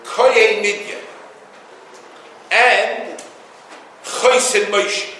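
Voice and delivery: slow, drawn-out recitation of the verse; Torah quote. Real, not acted. Rabbi quoting the Torah verse in slow recitation. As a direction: intone the verse slowly. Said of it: "It says".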